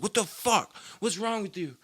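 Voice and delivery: put-on high, startled old man voice; mock alarm at a fallen grandson; shocked Grandpa. Grandpa finding grandson fallen from car, high startled voice. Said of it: "he's like".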